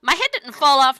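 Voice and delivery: booming cowboy wonder; loud delight. Acted. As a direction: marvel out loud. Sunny marvels at Dolly.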